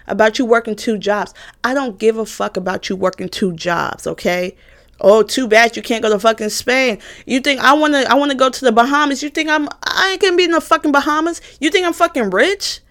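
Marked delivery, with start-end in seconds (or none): none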